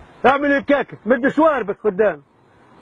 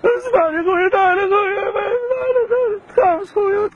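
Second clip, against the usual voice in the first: mock crying; 9 semitones higher than usual. Prankster fakes tears to calm the scared victim, sobbing. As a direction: fake a breaking, weepy plea. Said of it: "والله بكيت يا خويا".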